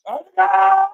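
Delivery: high, loud cry of the name; rising goal scream; goal shout. Real, not acted. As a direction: yell the scorer's name in joy.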